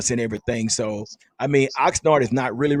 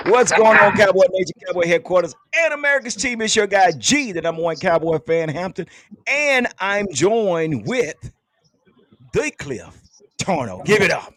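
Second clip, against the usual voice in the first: louder, higher podcast host announcing the show; hyped show opener; 5 semitones higher, 4 dB louder than usual. Acted. Host greets Cowboy Nation and introduces co-host, hyped announcing.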